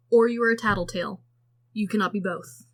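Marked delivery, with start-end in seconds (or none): none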